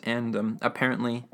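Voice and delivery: ordinary talk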